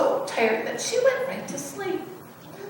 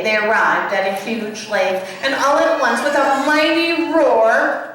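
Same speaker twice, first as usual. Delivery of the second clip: ordinary delivery, nothing marked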